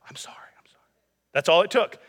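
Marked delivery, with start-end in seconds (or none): 0.1-0.9 tiny whispered sorry; whispered apology. Retold or imitated. Little son whispering an apology to his dad, tearful whisper. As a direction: breathe it out as a scared child.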